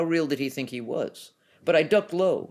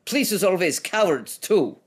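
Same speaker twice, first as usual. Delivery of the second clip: higher, louder Eastern European taunt; 6 semitones higher, 5 dB louder than usual. A narrator's reading. Hulk taunts the investigator, Eastern European accent, louder. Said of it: "His accent was Eastern European".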